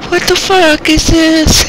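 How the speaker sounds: high, slow squeaky voice; high-pitched. Pretend. High stretched shout.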